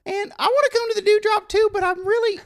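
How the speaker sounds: high-pitched awkward store guy; squeaky oddball coworker voice; high character voice. Acted. Clint character continues.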